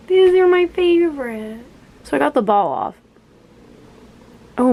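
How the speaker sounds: normal talking voice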